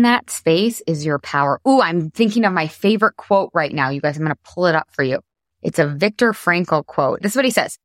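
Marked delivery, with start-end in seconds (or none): none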